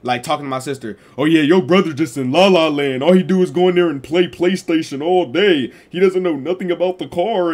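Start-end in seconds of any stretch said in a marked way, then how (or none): 1.2-7.5 louder, higher mock of the nagging dad. Dad talks down about his son to the sister, loud mocking voice. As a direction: ham up dad glazing him.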